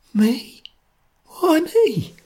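high, small frightened voice; faint scared question. Acted. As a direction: ask it weakly, scared. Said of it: "asked faintly".

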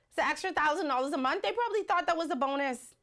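ordinary talk

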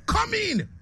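high, laughing "come in"; giggling invitation. Real, not acted. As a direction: laugh the words out. Host laughing through a retold exchange.

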